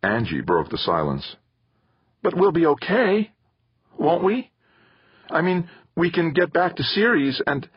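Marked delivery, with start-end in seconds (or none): 2.2-7.7 put-on a higher girl's voice, worried and pleading; little sister hoping it will be fine; anxious young girl.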